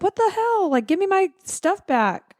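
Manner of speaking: high-pitched indignant protest; mock outrage. Retelling her chasing stolen luggage, high mock outrage. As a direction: imitate her squawking in disbelief.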